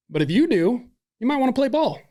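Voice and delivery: med student daring the teen to cooperate, mock casual; retold challenge. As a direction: lay down the offer, high and loud. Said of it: "I said".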